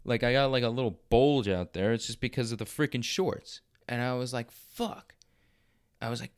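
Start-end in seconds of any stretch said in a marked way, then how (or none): 4.5-5.2 mock dismay. Self-quote seeing bulge in shorts.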